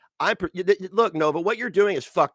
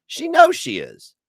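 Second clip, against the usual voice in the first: emphatic outburst; 11 semitones higher, 7 dB louder, slower than usual. Real, not acted. Ranting about Nova exploiting things.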